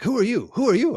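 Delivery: high, loud mock challenge; imitated confrontation. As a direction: imitate a wild man demanding answers. Retold man confronting the storyteller.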